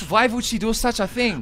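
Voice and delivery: mock-Russian questioning; Russian news host. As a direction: press for motives in a Russian accent. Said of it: "pretend you're a Russian news agency".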